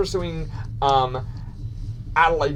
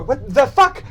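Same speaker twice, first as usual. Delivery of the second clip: raised, disbelieving exclamation; startled curse; 8 semitones higher, 4 dB louder, slower than usual. Acted. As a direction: react in sudden shock. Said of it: "we go".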